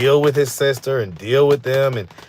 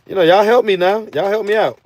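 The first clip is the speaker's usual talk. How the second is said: higher, louder plea to y'all; pleading with viewers; 8 semitones higher, 4 dB louder than usual. Real, not acted. Streamer asking viewers for rehab help, raised plea. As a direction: call out to chat for help. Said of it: "pleading with viewers".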